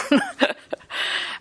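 high airy laugh without voice; breathy laugh. Real, not acted. Preacher laughing at himself for writing the recipe down. Laughing at failed dumpling attempts.

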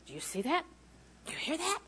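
soft acted questions, the second jumping high; hushed prompting. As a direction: ask it very quietly, lifting at the end. Voices the flesh's anxious prompting.

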